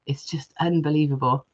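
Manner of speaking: normal talking voice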